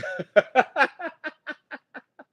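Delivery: loud high burst of laughter; big laugh. Real, not acted. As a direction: laugh out loud at your own joke. Laughing at own joke.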